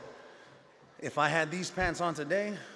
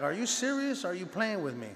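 high-pitched, incredulous questioning; 5 semitones higher than usual. Retold or imitated. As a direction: ask it in retold disbelief, pitch jumping up. Disbelief at a job offer, retold.

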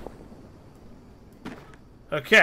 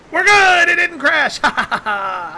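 very high, loud cheer into laughter; elated relief; 12 semitones higher, 11 dB louder than usual. Real, not acted. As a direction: shout with joy, then laugh. Relief the game works.

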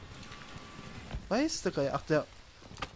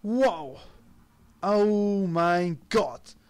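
drawn-out amazement; 2 semitones higher, 3 dB louder, much slower than usual. Real, not acted. Reacting to the cutscene.

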